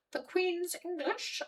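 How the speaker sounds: slow, prissy mock posh British; drawled mock posh English accent. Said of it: "sometimes there is a poshness".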